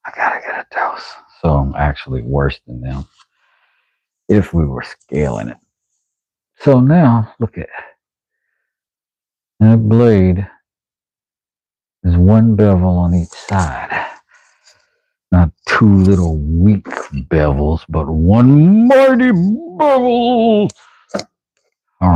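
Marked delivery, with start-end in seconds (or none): 0.1-1.1 whispered repeat, fast; a quick conspiratorial whisper. Shown as a demonstration. 17.9-20.7 high, slow, hammed-up proclamation; a grand showman voice, much higher and slow; mock grandeur. Mock grand praise of bevel.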